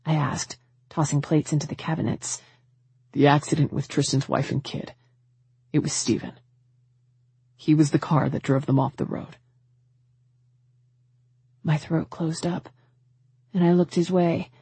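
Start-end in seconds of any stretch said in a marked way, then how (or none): 3.1-9.4 grave brother, voiced lower; low, urgent, pressing questions; lower male voice. A narrator's reading. Tanner's voice in the story.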